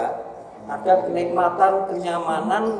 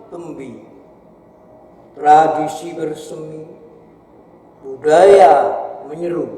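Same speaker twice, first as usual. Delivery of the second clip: measured declamation with long pauses between phrases; poetry recitation; 4 dB louder than usual. Acted. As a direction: recite a poem aloud, phrase by phrase. Recites a poem on stage.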